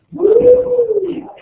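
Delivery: high, loud "woo"; excited whoop. Pretend. Teacher rallying the class, excited whoop. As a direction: cheer with a long high whoop.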